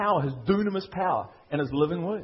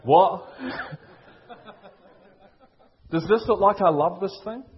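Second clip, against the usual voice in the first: laughing loudly; 5 dB louder than usual. Real, not acted. Laughing at his football passion.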